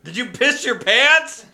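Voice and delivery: high, drawn-out mock astonishment; imitated disbelief. Friends reacting to Sean's confession, imitated disbelief. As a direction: ask it slow and high, acting stunned. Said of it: "we're just like".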